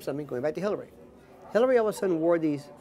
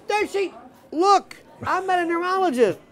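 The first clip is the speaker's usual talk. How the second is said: exasperated protest; 11 semitones higher, 5 dB louder than usual. Retold or imitated. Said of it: "And I'm saying".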